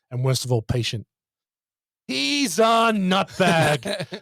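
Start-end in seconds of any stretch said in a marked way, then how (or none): 2.1-4.2 high, exclaimed mock outburst, stretched at the start; hammed-up indignant quote; exasperated film quote. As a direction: draw out the insult, then rattle off the punchline. Acted film quote, others laugh.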